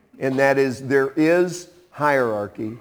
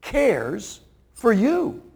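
tender emphasis; 6 semitones higher, much slower than usual. Real, not acted. Preacher tenderly assuring the congregation God cares. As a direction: say it gently so it sinks in. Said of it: "It says".

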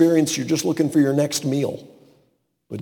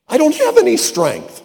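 high, pleading whine; whiny protest; 4 dB louder than usual. Pretend. Gideon whining to God that he is weak, high protest. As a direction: protest like a timid excuse-maker.

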